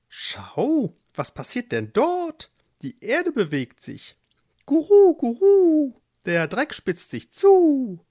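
hohes, lautes Guru Guru, aufgeregt; gurrende Tauben. Acted. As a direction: gurren wie nervöse Tauben im Garten. (Tauben im Hexengarten sehen die Erde sich bewegen, hoch und gurrend)

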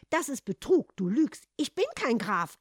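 loud, agitated objection; heated protest. A narrator's reading. Hans rejects the cat's plan, heated protest. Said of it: "ereiferte sich Hans".

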